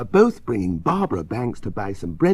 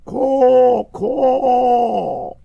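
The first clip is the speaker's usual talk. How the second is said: loud, high, long-drawn caw; crow cawing; 12 semitones higher, 8 dB louder than usual. A narrator's reading. Crow warning the children to go home, cawing. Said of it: "says the crow".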